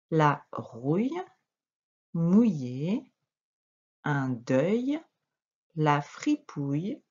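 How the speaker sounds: slow, drawn-out words with pauses between; word-list read-aloud. Shown as a demonstration. Teacher rereading all the words to a pupil, slow and deliberate. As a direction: read each word out slowly, on its own. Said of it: "je te relis tous les mots".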